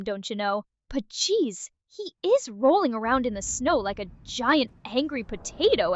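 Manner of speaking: put-on folksy Wisconsin accent with sing-song lilt. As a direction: play it as a chipper Wisconsin local. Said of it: "That's your new accent".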